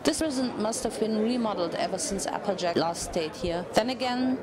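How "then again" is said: ordinary delivery, nothing marked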